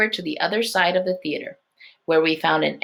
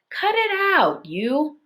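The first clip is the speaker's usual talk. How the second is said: shouting; 9 semitones higher, slower than usual. A narrator's reading. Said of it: "Alex shouted".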